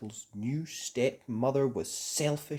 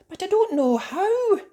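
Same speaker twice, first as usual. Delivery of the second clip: put-on much higher, louder child voice; innocent Gretel; 8 dB louder than usual. A narrator's reading. Gretel tricking the witch, high and innocent. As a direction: play innocent. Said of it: "Gretel replied".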